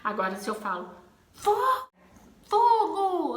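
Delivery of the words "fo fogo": much higher, louder fogo; alarmed shout. Shown as a demonstration. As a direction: yell fogo to warn. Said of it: "Usando uma entoação".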